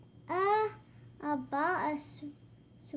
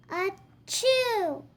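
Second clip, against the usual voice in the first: loud high sneeze; big sneeze burst; 4 semitones higher, 5 dB louder than usual. Acted. Sneeze sound in story.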